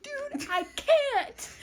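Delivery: high, drawn-out helpless protest; hammed-up helplessness; mock exasperation. Healer helplessly answering distraught mother.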